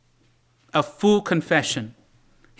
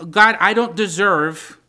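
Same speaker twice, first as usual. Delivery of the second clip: loud, impassioned confession to God; a preacher voicing David's urgent prayer; pleading; 3 semitones higher, 5 dB louder than usual. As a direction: plead with God, building in force and easing at the end. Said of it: "it's pleading for the mercy of God".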